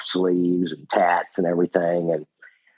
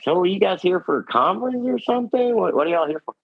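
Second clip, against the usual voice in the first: curious waiter; 11 semitones higher than usual. Retold or imitated. Retelling the diner waiter.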